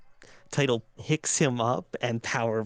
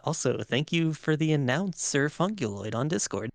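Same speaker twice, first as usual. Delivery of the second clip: usual speaking voice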